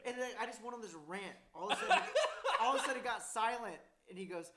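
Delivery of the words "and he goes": ordinary talk, nothing marked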